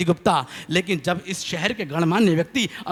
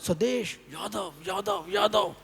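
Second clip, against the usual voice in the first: mock echo; 7 semitones higher, slower than usual. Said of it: "आवाज़ लगाई".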